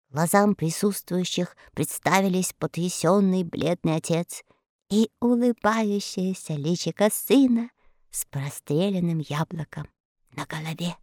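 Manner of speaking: plain reading voice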